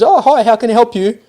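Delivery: high, bright mock teller greeting; sweet teller voice. Teller greeting a customer, imitated in a high sugary voice. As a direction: chirp it like an overly sweet bank teller. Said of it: "she says".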